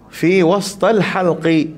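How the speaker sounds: slow recited Fi wastal halqi; Arabic phrase. Shown as a demonstration. Teacher recites the Arabic term for students. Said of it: "di tengah halqum".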